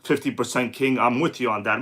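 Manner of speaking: ordinary talk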